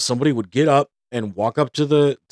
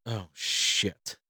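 quiet dismay; 6 semitones lower, 14 dB quieter than usual. Retold or imitated. Hearing nobody was seen.

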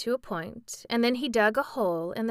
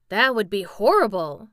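a shuddering, louder cry of horror; 9 dB louder than usual. A narrator's reading. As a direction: shudder at the thought. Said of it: "said Jack with a shudder".

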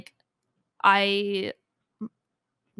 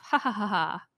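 polite fake laugh. Polite laugh at his joke.

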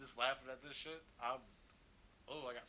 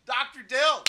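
much louder, higher exclamation; excited realization; 10 semitones higher, 19 dB louder, slower than usual. Real, not acted. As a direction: burst out as it clicks. Sudden recognition.

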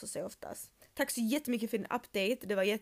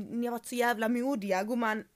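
normal talking voice

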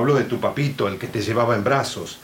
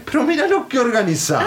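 incredulous; 10 semitones higher, faster than usual. Acted. Incredulous repeat, higher, faster.

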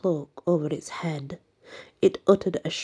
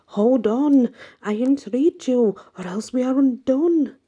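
high, loud pleading in mortal terror; terrified plea; 8 semitones higher, 5 dB louder than usual. A narrator's reading. The priest begs Job to hold the dog, terrified. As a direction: cry out, begging in terror. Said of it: "cried the priest in mortal terror".

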